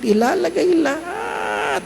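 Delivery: slow high mock wail; mock whine. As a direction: whine it like a glutton. Mocking repeat, whiny.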